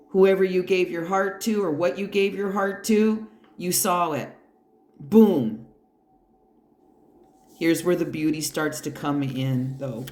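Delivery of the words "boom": punchy boom, louder; mock explosion; emphatic boom. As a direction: drop it like a mic. Mask off revelation.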